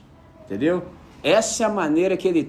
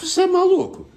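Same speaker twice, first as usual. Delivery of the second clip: higher, louder incredulous question; mock astonishment; 14 semitones higher, 4 dB louder than usual. Classmates asking if he's crazy, high and incredulous. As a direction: toss it up in disbelief.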